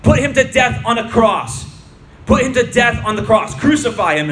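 high, loud shouted demand; crowd shouting. A narrator's reading. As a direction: shout it like an angry mob. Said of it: "they shouted".

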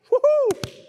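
high, loud whoop of pretend alarm; mock scandalized whoop. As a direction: whoop in exaggerated horror. Mock alarm at movie outing.

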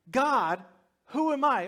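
high-pitched arguing with mild protest. Retold or imitated. Moses arguing with God at the burning bush, mock protest. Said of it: "he starts arguing with God".

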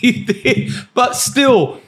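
laughing through the words; words broken up by laughter; giggling while agreeing. Real, not acted. Laughing at lost every task.

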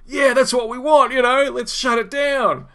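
high, excited mock crowd cheer, slowing at the end; hammed-up workers egging each other on; eager crowd. Workers at the union headquarters urging a shutdown, high excited mock cheer. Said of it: "they're like".

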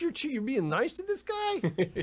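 high, drawn-out disbelief; slow, high-pitched mock outrage; imitated incredulous cop. Officer to the lady being nice to painter, incredulous. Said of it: "looking at her going like".

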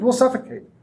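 high alarmed exclamation; exclaimed. Acted. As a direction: blurt out the alarm. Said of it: "Dale exclaimed".

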